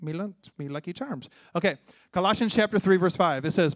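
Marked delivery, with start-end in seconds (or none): 0.0-1.3 softer, joking mock-Irish voice; Irish accent. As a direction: toss off a playful Irish accent. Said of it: "Irish accent coming out".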